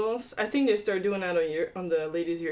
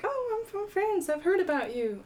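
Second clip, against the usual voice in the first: lightly voiced French player, much higher; French woman; 12 semitones higher than usual. Light mock French voice, a French player greeting the young golfer. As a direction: imitate the friendly stranger in a high voice. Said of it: "And she's like".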